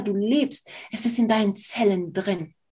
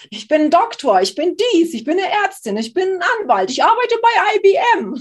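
höher und lauter, empört aufzählend; imitiertes Protestieren; 8 semitones higher, 7 dB louder than usual. Retold or imitated. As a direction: spiel den gekränkten Akademiker. Imitiert Intellektuelle, die sich wehren. (empört aufzählender Akademiker, höher und lauter)

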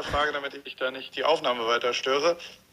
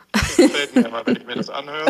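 high, laughing and louder; 7 semitones higher, 5 dB louder, faster than usual. Real, not acted. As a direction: laugh through the compliment. Laughing compliment on phone.

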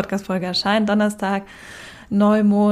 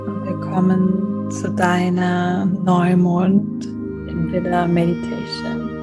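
calm, unhurried meditation guide; guided meditation. Acted. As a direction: lead the breathing slowly and calmly. Leads a meditation over music.

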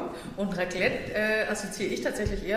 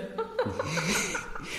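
high, breathless giggle; real laugh. Real, not acted. Sommelière laughing about working over Christmas, real laugh. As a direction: laugh it off, amused at herself.

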